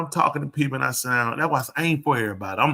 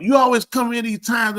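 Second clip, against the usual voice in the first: higher, louder annoyed complaint; irritated complaint; 9 semitones higher, 5 dB louder than usual. Retold or imitated. Wife complaining husband always interrupts, irritated. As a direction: complain he always picks the wrong moment.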